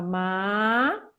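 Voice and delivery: "ma" drawn out, sliding upward; rising second tone. Shown as a demonstration. Teacher showing second tone, "ma" rising. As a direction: let "ma" climb to the end. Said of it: "segundo tom que sobe".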